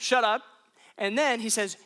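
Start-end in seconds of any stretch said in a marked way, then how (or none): 0.0-0.4 loud, abrupt order; punchy acted command; forceful command. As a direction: shut the demon down, playfully fierce. Acts out commanding a demon.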